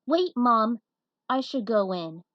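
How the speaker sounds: urgent call. Acted. Daughter stops her mother.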